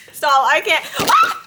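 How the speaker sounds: loud, high laughing through tears; overwhelmed, laughing and crying at once; real emotional outburst. Real, not acted. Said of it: "Why am I crying on this scene?".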